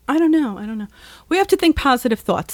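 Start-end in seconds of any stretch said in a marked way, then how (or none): 0.6-0.9 much softer, trailing under the breath; muttered. Real, not acted. Overwhelmed podcaster mutters to himself, very soft. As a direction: mumble it to yourself, giving up. Repeated, dropped to near silence.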